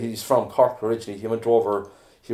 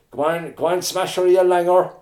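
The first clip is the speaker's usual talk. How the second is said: heckling shout; 8 semitones higher, 5 dB louder than usual. Retold or imitated. Extra heckling from the crowd.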